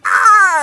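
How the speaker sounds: very high, loud, sustained scream; mock scream. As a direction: imitate someone screaming as they are yanked away. Monaka grabbed by Goku.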